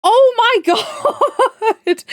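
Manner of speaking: high, loud squeal breaking into laughter; thrilled reaction. Retold or imitated. Streamer retells hearing Anna had graduated, thrilled squeal. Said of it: "and I was like".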